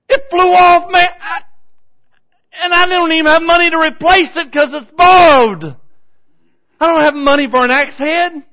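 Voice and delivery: high, loud, wailing shout; acted-out frantic yelling; desperate cry for help. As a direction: cry out to the prophet in panic. Acts out man crying to Elisha.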